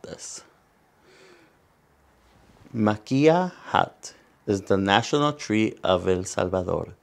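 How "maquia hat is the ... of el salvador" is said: usual speaking voice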